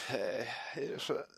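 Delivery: Stimme versagt, leise und brüchig; voice giving out. Real, not acted. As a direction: voice fading out, strained. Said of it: "Meine Stimme versagt". (Stimme, die gerade wegbricht)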